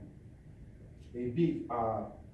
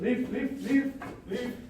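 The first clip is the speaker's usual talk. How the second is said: higher, insistent leave leave; loud order out; 10 semitones higher, 3 dB louder, slower than usual. Real, not acted. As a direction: order them out firmly. Ordering someone out, repeated.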